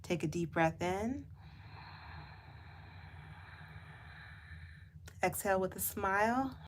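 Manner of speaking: gentle meditation voice with long pauses; guided meditation. Real, not acted. Guide asking listeners to close eyes and float, guided meditation. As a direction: lead them gently inward, lingering on words.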